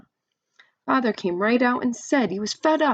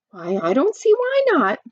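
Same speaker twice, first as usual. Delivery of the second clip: surprised mother; 7 semitones higher, 5 dB louder than usual. A narrator's reading. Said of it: "Mother sounded surprised".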